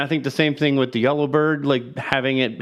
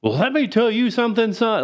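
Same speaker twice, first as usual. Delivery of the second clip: higher boastful southern rooster; mock southern lecture, higher; Foghorn impression; 8 semitones higher than usual.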